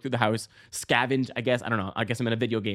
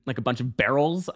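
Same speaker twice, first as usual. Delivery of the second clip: ordinary talk, nothing marked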